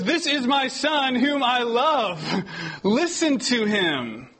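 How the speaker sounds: high, slow, solemn mock God voice; God rebuking the disciples, slow and high. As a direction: pronounce it slowly from on high. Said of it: "He goes".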